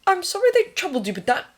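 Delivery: high, quick, formal apology; stiff apology. A narrator's reading. Thomas apologises to the nurse, stiffly. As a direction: get the polite words out stiffly. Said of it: "Thomas said stiffly".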